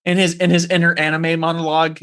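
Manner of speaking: ordinary delivery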